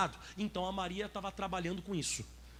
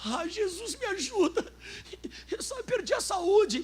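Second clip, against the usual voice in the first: high, wailing parody of a desperate believer; mock tearful plea; 11 semitones higher, 4 dB louder, slower than usual. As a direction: beg Jesus in a hammed-up sob, drawing it out. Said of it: "você chega assim pra Jesus".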